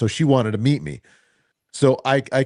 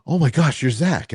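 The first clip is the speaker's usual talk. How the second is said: mock-gasping, higher and brighter as an excited fan; imitating a thrilled fan, gasp then higher pitch; starstruck fan gasp; 6 semitones higher than usual. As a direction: play the fan who just recognised the radio DJ. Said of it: "people were like".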